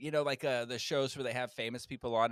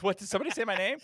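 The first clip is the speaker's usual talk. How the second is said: higher-pitched celebrity popping in; lightly voiced eager cameo; Andy Cohen; 7 semitones higher, much faster than usual. Celebrity guest pops out on hearing himself mentioned, playful impression. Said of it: "and Andy Cohen goes".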